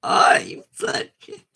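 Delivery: breathy high squeak that cracks; a cracked, half-whispered high attempt; failed voice try. Shown as a demonstration. Streamer fumbling a character voice, cracked high squeak. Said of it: "I can't do it like that".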